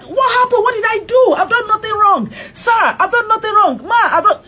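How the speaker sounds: high, loud frantic protest; panicked pleading. Retold or imitated. Stopped driver pleading with officers, high panicked protest. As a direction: plead your innocence in a panic. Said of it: "I'm like".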